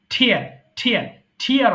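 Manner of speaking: "টিয়ার" opening on a puffed ঠ sound; aspirated t. Shown as a demonstration. Teacher telling learners to watch the ঠ, aspirated t. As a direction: start "টিয়ার" with a strong breath on the t. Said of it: "দেখেন ঠ".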